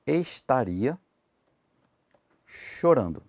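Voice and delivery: very slow, word by word with long pauses; slow dictation. Shown as a demonstration. Teacher dictates the example sentence to students, very slowly. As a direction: spell the sentence out slowly, one word at a time. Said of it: "se eu falasse assim, ó".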